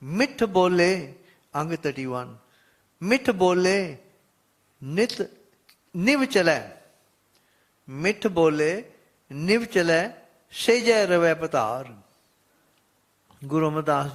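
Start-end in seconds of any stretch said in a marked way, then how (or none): 0.0-1.1 raised, louder devotional chanting tone; reciting Gurbani with devotion; scripture recitation. Acted. 3.0-11.9 raised, louder devotional chanting tone; reciting Gurbani with devotion; scripture recitation. Acted.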